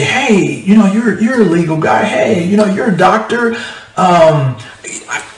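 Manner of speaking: high, eager party guest asking for free advice; eager partygoer. Retold or imitated. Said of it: "you hear the, the epiphany".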